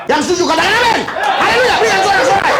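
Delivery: high, loud preacher's rallying cry; rousing call. Real, not acted. Preacher urges the congregation to shout for joy, high and loud. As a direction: fire up the crowd to cheer.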